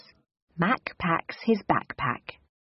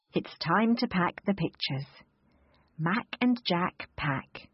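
plain reading voice

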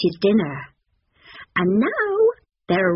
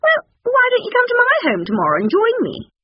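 high, quick stork voice; brisk, high-pitched bird character; 4 dB louder, faster than usual. A narrator's reading. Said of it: "suggested Stork".

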